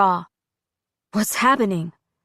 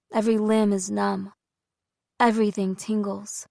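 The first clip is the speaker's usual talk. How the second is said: ordinary delivery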